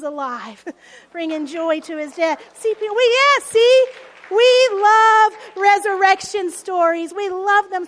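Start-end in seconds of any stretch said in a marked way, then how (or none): 2.5-7.9 excited preacher. Real, not acted.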